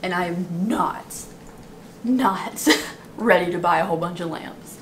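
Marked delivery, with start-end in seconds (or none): none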